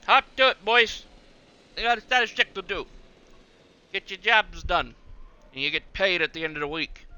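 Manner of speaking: a lightly hammed-up park boss; odd put-on voice. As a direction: play the boss barking orders at workers. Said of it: "I don't know why I'm talking like that".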